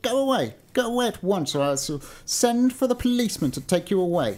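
high, indignant dismissal; crying out. A narrator's reading. Old gentleman threatening to send for the policeman, angry cry. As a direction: order the beggar off in a temper. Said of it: "cried the old gentleman, stamping his foot".

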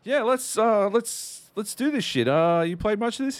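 high, loud, keen voice; hammed-up friendly enthusiasm; eager retold agreement. Gamer agrees to team up with stranger, eager high voice.

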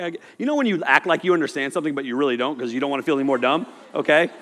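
usual speaking voice

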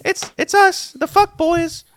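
high-pitched little creature voice; Griddle. Acted. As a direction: answer up high, announcing the group. Griddle answers the pit creature.

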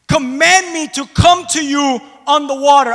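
full-voiced shout, high and loud; 1 preached shout. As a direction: shout it to the rafters. Preacher shouting Peter's request.

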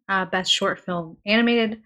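normal talking voice

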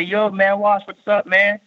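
lightly voiced buddy calling out a hello; friendly street greeting. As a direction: echo an old friend hailing him down the hall. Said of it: "somebody say".

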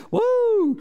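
loud high whoop of joy; joyful whoop. Real, not acted. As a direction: let out a loud high woo. Exultant shout.

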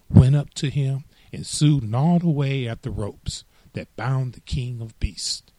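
ordinary delivery

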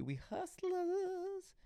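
very slow, stretched-out playful boast; goofy elongated catchphrase; odd drawn-out delivery. Real, not acted. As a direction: stretch the last word way out. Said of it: "I don't know why I did said that in the way I did".

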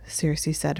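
plain reading voice

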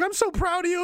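high-pitched mock wife voice; proud wife. Wife telling the new leader she's proud, mock gushing. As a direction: lift the pitch into gushing wifely praise. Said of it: "his wife is like".

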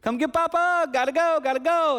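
hurried call. Retold or imitated. Grandpa calling grandkids for a hug, loud high call. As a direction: call the kids over, bright and urgent. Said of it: "I said to him and his sister".

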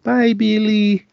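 high, slow little creature goodbye; Gizmo voice. Retold or imitated. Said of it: "then he's like".

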